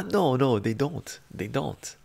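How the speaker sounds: ordinary delivery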